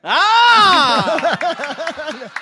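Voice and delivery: high yelp of mock despair; mock yell. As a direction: cry out in hammed-up anguish. Mock outburst at request.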